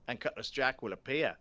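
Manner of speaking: put-on loud, hearty Australian accent for the ghost storyteller. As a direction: play it as a booming Australian bloke. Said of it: "he looks like he's Australian".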